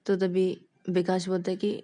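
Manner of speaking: ordinary delivery, nothing marked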